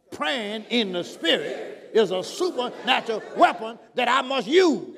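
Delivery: loud, commanding declaration building to a shout; forceful preacher's proclamation, rising at the end; with authority. Shown as a demonstration. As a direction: proclaim it with vigor, punching each phrase. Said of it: "you gotta say it with authority".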